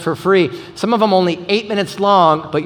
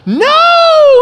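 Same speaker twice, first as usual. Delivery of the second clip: much higher, loud mock shriek; freaking out; 9 dB louder than usual. Pastor retelling his shock at his son, loud mock yell. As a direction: shout the denial in mock panic. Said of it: "like freaking out".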